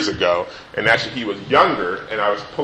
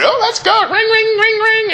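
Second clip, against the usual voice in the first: put-on very high, eager little-boy voice ringing a bell; high, excited mock child; excited kid. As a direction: play the eager son racing ahead. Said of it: "he was going".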